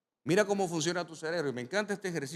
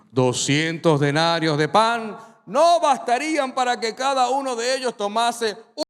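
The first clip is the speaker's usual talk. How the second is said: loud doubting Felipe; 6 semitones higher, 11 dB louder than usual. Retold or imitated. Felipe quoted doubting aloud.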